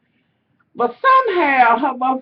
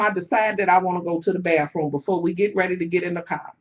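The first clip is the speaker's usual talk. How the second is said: normal talking voice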